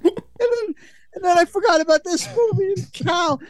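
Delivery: laughing hard; cracking up while talking. Real, not acted.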